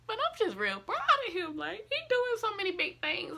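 fighting back tears of pride; getting emotional. Real, not acted. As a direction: voice rising high with emotion. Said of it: "I don't wanna get emotional".

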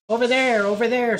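high, loud call of over there; shouting. Acted. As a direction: shout to point out where. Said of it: "somebody shouted".

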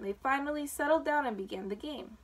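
usual speaking voice

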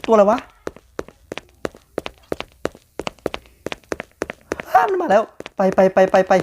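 high, frantic run-run-run panic; panicked yelling. Real, not acted. Player fleeing a Backrooms monster, panicked high yelling. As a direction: scramble away in real fright.

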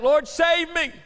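high, loud desperate plea; crying out. Retold or imitated. Peter sinking in the waves, loud desperate cry. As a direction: cry it out like a drowning man. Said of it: "Peter cried out".

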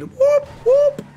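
high-pitched mock siren whoops; police siren whoop. Nowhere to be seen, the police, mimicked siren whoop.

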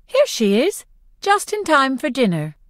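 high-pitched, overly bright welcome; too bright. A narrator's reading. Mother greeting her late daughter before Peacekeepers, too brightly. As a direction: chirp it a little too brightly. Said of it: "she says a little too brightly".

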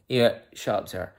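normal talking voice

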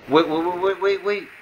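high, loud, rapid-fire waits; rising panicked repetition; urgent alarm. Real, not acted. Sudden alarm during the game.

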